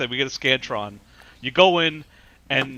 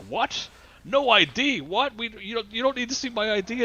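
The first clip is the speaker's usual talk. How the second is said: higher, mock outraged disbelief; indignant old man; 8 semitones higher than usual. Crotchety old man to poll worker, mock outraged disbelief. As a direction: play the cranky old voter, stunned.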